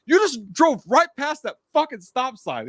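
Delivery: high, loud, drawn-out rebuke; retold angry yelling. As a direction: imitate a cop yelling in outrage. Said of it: "he was yelling".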